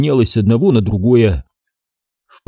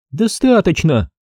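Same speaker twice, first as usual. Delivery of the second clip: slow, higher, curt snap; snapped retort; 8 semitones higher, slower than usual. A narrator's reading. Girl answers the teasing lieutenant, curt and offended. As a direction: bite back indignantly. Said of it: "огрызнулась та".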